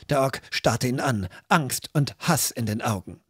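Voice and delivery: usual speaking voice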